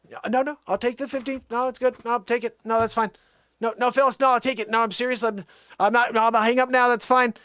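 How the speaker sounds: high, agitated phone protest; insistent protest. Pretend.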